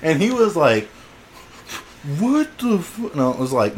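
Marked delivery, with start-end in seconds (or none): 2.2-3.1 high-pitched, cut-off disbelief; retold shock. Tackled kid reacts to the attack, shocked. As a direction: imitate a stunned, squeaked reaction.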